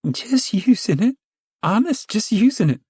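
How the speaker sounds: very high anxious plea; pleading whine. Acted. Flustered woman pleads.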